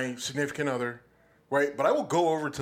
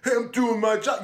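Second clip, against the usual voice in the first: Chauvin, defensive; 6 semitones higher than usual. Retold or imitated. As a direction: brush off the colleague angrily. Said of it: "Chauvin would've been".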